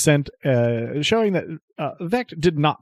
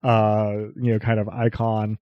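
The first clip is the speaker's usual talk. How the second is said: normal talking voice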